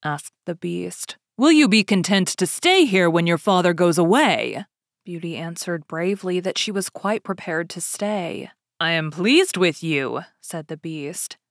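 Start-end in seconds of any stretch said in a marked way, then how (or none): 1.4-4.6 louder, imposing Beast voice; Beast's question. Acted. 8.8-10.2 louder, imposing Beast voice; Beast's question. Acted. Beast speaks.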